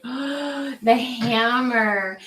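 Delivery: drawn-out, breathy gasp at the word hammer; mock gasp. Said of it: "I'm like, gasps".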